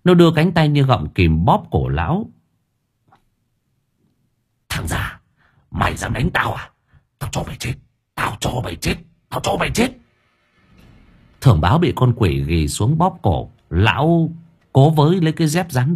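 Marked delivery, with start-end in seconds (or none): 4.7-9.9 hushed, rasping death threat rising in pitch; soft, strained menace climbing higher with each repeat; vengeful ghost. A narrator's reading. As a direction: throttle him with a half-whispered curse. Ghost strangles the old man.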